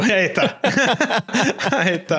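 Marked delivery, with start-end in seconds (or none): 0.0-0.6 said through a laugh. Real, not acted. Laughs at cheating joke. 0.6-1.7 a high burst of laughter. Real, not acted. Laughs at cheating joke.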